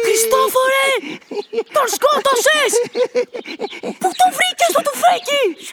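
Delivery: stunned friend after a killing; shocked cry. Acted. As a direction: gasp it out in disbelief.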